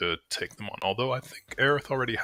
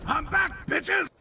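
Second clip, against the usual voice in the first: excited shout; much slower than usual. Real, not acted. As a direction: burst back in, crowing. Returning to mic after break.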